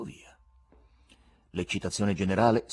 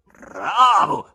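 loud, brief growl; growled shout; 10 semitones higher, 15 dB louder than usual. A narrator's reading. Heckler in the gallery to the showman, growled cheer. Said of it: "ringhiò brevemente una voce di basso".

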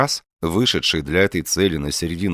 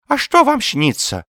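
put-on high, louder old man's voice; curious old man, high lisping voice; inquisitive old man; 5 dB louder than usual. A narrator's reading. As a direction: ask it eagerly, pitched high. Old man questions Ostap.

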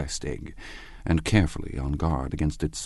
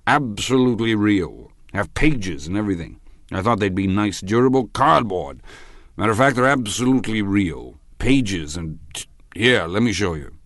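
tipsy, higher-pitched insistence; raised, emphatic drunken wonder; drunken man; 4 semitones higher, 4 dB louder than usual. A narrator's reading. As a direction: marvel loudly like an earnest drunk. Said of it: "sitting somewhat drunk".

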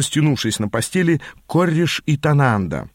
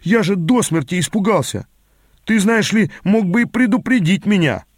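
raised-pitch reproach; aggrieved protest, pitched up; indignant; 6 semitones higher than usual. A narrator's reading. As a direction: complain indignantly, voice climbing high. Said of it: "возмутился я".

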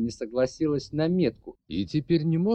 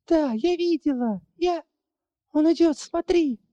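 high, panicked voice; frightened urging; 4 dB louder than usual. Acted. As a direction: urge her on in fear. Frightened girl urges looking.

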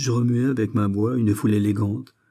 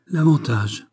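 a higher, wry, sighing "L'avantage"; ironic sigh; 5 semitones higher, 3 dB louder, slower than usual. A narrator's reading. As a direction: let the word drip with irony. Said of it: "soupira Antonine avec ironie".

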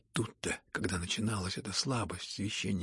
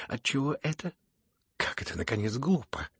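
higher, cutting indignant retort; 3 semitones higher, 4 dB louder than usual. A narrator's reading. The general's wife rebukes Aglaya, indignant retort. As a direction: cut her off with indignation. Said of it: "отрезала генеральша с негодованием".

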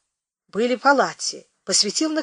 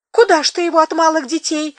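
high, tearful plea; woman pleading, on the edge of tears; sobbing plea; 9 semitones higher, 6 dB louder than usual. Acted. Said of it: "всхлипнула хозяйка".

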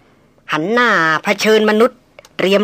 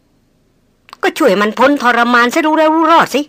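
higher, slow, decisive leader voice; 5 semitones higher, much slower than usual. A narrator's reading. Team leader orders an end to its suffering, decisive. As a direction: settle it with authority.

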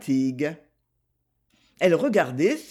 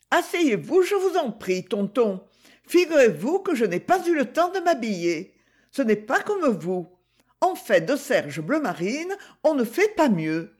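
put-on higher, lively girl's voice; higher, playful young girl; Gigi's voice; 6 semitones higher than usual. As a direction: play the teasing young girl greeting her uncle. Gigi voiced in read story.

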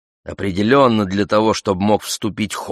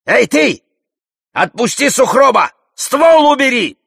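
high, loud shouted orders; Алёш; 7 dB louder than usual. A narrator's reading. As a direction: yell commands at an armed stranger. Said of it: "Алёш кричит мне". (громко выкрикивает приказы)

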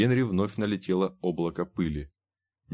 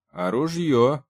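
put-on raised, louder teenage question; hopeful question; 6 semitones higher, 5 dB louder, slower than usual. A narrator's reading. Teenager asking a trader for a gun, hopeful. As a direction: ask it with eager hope. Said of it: "с надеждой спросил один подросток".

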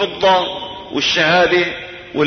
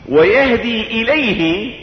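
ويهدي إليه drawn out slowly, rising on ويهدي; slow recitation; 3 semitones higher, slower than usual. Shown as a demonstration. Preacher repeating the verse slowly to the congregation. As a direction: stretch ويهدي إليه in a chanting lilt. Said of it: "إلى الله".

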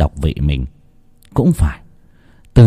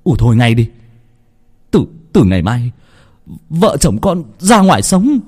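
firm outburst, rising at the end; moderately raised, indignant voice; angry shout; 4 semitones higher than usual. A narrator's reading. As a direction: cut your mother off with an angry declaration. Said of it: "Vinh quát lớn".

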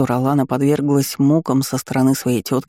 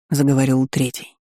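usual speaking voice

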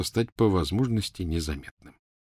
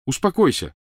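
raised, urgent order; higher-pitched, urgent cry; shouted command; 7 semitones higher, 4 dB louder than usual. Acted. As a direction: call out to calm her down. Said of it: "крикнул Гарри".

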